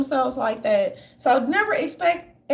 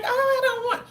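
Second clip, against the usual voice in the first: high whiny mock voice; mock whiny wife; 11 semitones higher than usual. Wife leaving her husband, whined in a high mocking voice. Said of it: "don't be like".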